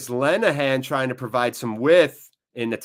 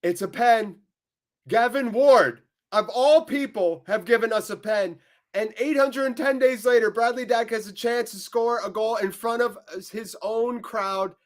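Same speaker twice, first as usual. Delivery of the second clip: pitched high and louder, slowing to savour the moment; excited penalty call; 8 semitones higher, 4 dB louder than usual. Real, not acted. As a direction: announce the penalty like a thrilled commentator. Fan calls a penalty awarded.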